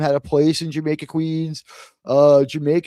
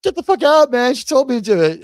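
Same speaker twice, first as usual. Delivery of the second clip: exasperated outburst; 7 semitones higher, 4 dB louder, faster than usual. Retold or imitated. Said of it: "which he was just like".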